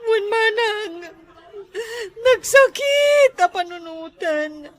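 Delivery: slow, sobbing lament; crying. Acted. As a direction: drag the words out through sobs. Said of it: "apay nga rod nga agsangit-sangit ka".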